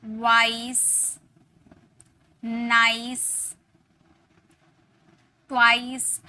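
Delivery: slow clear model words, long आई sound; drawn-out model words. Shown as a demonstration. Teacher slowly modelling words with the आई sound. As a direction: stretch vice and nice slowly. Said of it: "और word हो सकते हैं जैसे".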